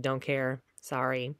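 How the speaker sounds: cold-hearted listener turns down the begging student, flatly; cold dismissal. Shown as a demonstration. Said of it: "your cold, dead heart".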